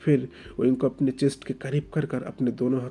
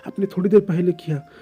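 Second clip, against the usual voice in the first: put-on higher, louder hurt young man, stammering; anguished outburst; 4 semitones higher, 5 dB louder than usual. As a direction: pour out the pain in a rising outburst. Oing's outburst about pain. (दर्द भरा फूटता हुआ ग़ुस्सा)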